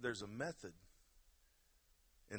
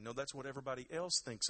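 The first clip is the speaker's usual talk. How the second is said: usual speaking voice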